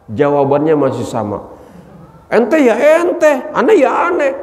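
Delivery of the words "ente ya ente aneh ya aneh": insolent retort. Acted. Nafsu still defying Allah, high and insolent. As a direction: drag it out smugly, thumbing your nose. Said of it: "kurang ngajar ini nafsu".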